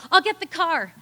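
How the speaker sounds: high, loud hurried call back; husband yelling. Retold or imitated. Husband answering from another room, loud yell. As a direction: shout back in a rush. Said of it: "And he yelled".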